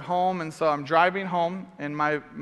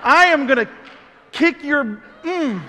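high, loud threatening shout; loud high mock threat; angry threat; 7 semitones higher, 6 dB louder than usual. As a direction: threaten him loudly. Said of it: "I was so angry".

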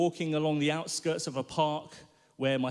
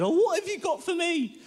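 much higher, louder nervous bluff; shaky voice; 14 semitones higher, 4 dB louder than usual. Retold or imitated. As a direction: fake bravado in a trembling voice. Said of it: "Probably in a very shaky voice".

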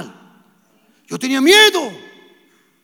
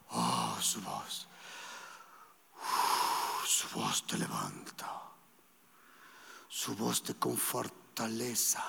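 soft, awed half-whisper, much lower; hushed awe; 10 semitones lower, 11 dB quieter, much slower than usual. Acted. Preacher marvelling at the Spirit's voice in a hushed whisper. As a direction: sink into a reverent hush.

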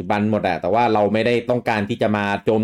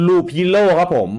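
slow, higher announcing of the title Loop Hero; show announcer; 8 semitones higher, 5 dB louder, much slower than usual. Acted. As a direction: present the game like a show host. Host announces next game.

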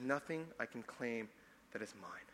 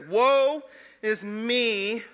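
loud, high, long drawn-out cry; Isaiah's lament; 7 semitones higher, 9 dB louder, much slower than usual. Acted. Prophet crying out before a holy God, anguished lament. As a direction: cry it out slowly, stretching each word.

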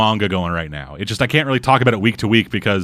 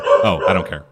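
lightly voiced bored, lower shrug; dismissive mock; 6 semitones lower than usual. Host voices Goblin Slayer dismissing the Dark Elf, bored and lower. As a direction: brush him off, lower and bored. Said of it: "it's like".

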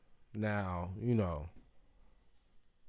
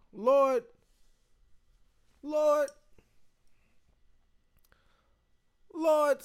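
high, loud cry to the Lord; overcome, high-pitched exclamation; swooning plea; 14 semitones higher, 4 dB louder, much slower than usual. Real, not acted. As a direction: call on the Lord in a smitten high voice. Swooning over Southern accents.

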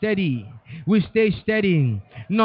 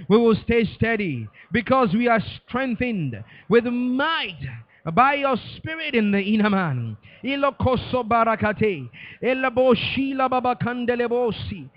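normal talking voice

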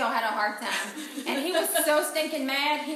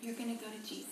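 tender farewell; 12 dB quieter, faster than usual. Retold or imitated. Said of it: "I said".

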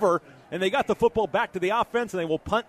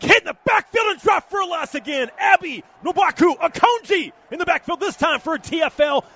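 excited; 5 semitones higher, 5 dB louder than usual. Real, not acted. Tackle for loss called.

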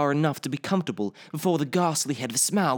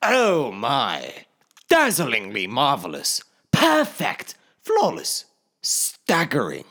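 gushing hobbit praise; 4 dB louder, slower than usual. Acted. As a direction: lay the flattery on thick.

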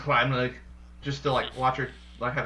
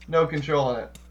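ordinary talk, nothing marked

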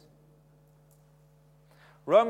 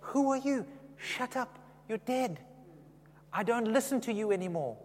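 dismissive rebuke; 7 semitones higher than usual. Retold or imitated. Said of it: "I say".

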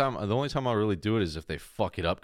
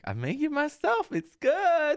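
very high, slow, beaming; mock proud barista; slower than usual. Barista bragging about his almond milk, very high and proud. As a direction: squeal it proudly like a hyper barista. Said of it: "It's like".

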